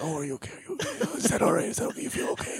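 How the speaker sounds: soft, tender imitation of checking in; hushed, caring. John checking on his partner, hushed and caring. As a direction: ask gently under your breath. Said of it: "very hushed".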